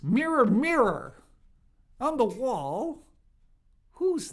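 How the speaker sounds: loud, high, swooping storybook chant; mock fairy-tale incantation. Commentator asking the magic mirror who's nastiest, mock incantation. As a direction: call out theatrically to a magic mirror.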